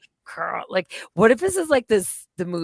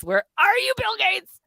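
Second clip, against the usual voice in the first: calling out; 13 semitones higher, 3 dB louder than usual. Pretend. Calling out to Bill Gates.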